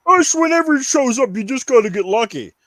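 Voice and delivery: high, lightly nasal-free mock staff voice, smug; mocking store staff. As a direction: parody the lazy employee brushing him off. Mocking GameStop staff on phone.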